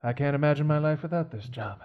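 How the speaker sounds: put-on world-weary old servant. Butler explains his despair.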